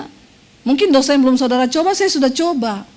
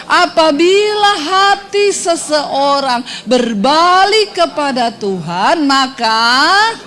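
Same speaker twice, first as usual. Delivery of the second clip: scripture reading; much slower than usual. Acted.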